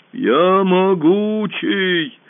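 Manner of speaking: very slow, loud booming boast; boasting monster. A narrator's reading. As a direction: boom out your might. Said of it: "раздался голос чудовища".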